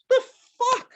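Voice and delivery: high, loud incredulous cry; exasperated why. Real, not acted. Fuming at a Clorox ad mid-show, shrill disbelieving why. Ranting at commercials.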